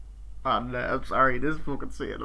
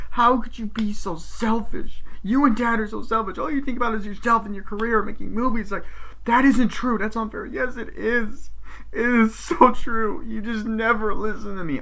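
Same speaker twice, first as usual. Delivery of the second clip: high-pitched impassioned argument; dramatic reproach, voice pitched up throughout; acted accusation; 10 semitones higher than usual. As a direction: imitate an actress in a heated family row. Acted quarrel from the film.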